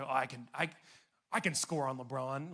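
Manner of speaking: low, understated mock bravado; false confidence. Buddy boasting he can score on LeBron, mock confident. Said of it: "there's false confidence".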